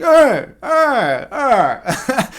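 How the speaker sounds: loud, high imitated groan of protest; mock drunk woman wailing at a car horn; annoyed drunken wail. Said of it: "I don't think she liked it".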